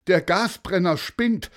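the pilot cries out about the broken burner; panicked balloon pilot. Acted. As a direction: blurt the bad news in alarm.

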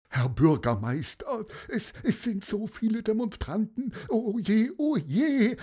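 high, stammering worried aide; fretting adviser. Acted. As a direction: wring your hands as the adviser. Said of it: "regte sich der Berater auf".

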